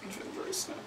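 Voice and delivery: mock sad. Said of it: "names own feeling".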